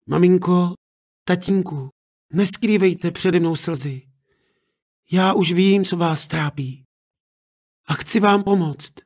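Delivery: a daughter comforting her parents; tender daughter. Acted.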